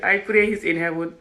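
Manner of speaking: ordinary talk, nothing marked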